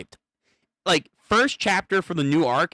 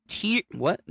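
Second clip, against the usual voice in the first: usual speaking voice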